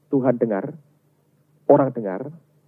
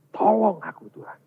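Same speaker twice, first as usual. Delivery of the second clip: restrained cry; 7 semitones higher than usual. Acted. Believer broken before God, restrained cry for help. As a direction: call for help, then let it drop. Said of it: "kita teriak dengan Tuhan".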